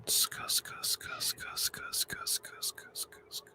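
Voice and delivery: soft unvoiced scuss-scuss mouth noises; fading whispered scuss trigger sounds. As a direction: hiss little ASMR mouth sounds, fading. Mock ASMR mouth sounds.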